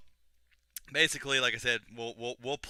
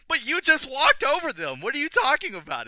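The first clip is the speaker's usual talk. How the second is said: high-pitched, loud comic outrage; mock incredulous protest; 13 dB louder than usual. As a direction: squawk it like baffled henchmen calling out the boss. Guards protest at Reno's hypocrisy.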